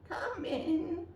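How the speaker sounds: high fake granny voice; wolf pretending to be granny; wolf faking granny. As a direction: disguise the wolf as sweet granny. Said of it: "snarled the wolf as softly as he could".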